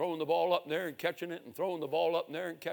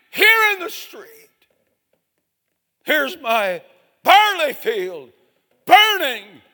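very high, loud, slow wail; anguished cry; 13 semitones higher, 15 dB louder, much slower than usual. Retold or imitated. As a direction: cry out to God in horror. Father sees son killed.

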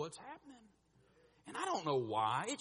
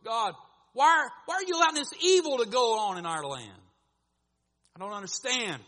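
louder, pleading cry to God; Habakkuk crying out; 8 semitones higher, 11 dB louder than usual. Retold or imitated. Habakkuk cries out to God, loud and pleading. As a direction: call out in anguish to God. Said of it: "Habakkuk calls out to God".

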